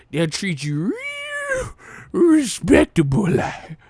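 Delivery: high, slow, squeaky movie voice; Billy Madison impression. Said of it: "he did the Billy Madison".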